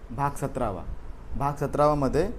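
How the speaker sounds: usual speaking voice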